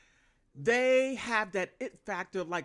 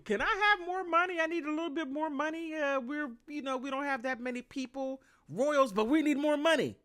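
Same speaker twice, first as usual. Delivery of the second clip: much higher whiny begging voice; mock begging royal; 8 semitones higher than usual. Vlogger imitating William begging people for money, high and whiny. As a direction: beg for money like a spoiled prince.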